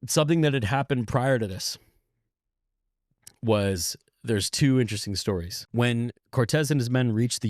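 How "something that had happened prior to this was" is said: normal talking voice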